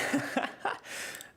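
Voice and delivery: high breathy burst of laughter; high airy giggle. Real, not acted. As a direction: laugh in disbelief. Laugh at game joke.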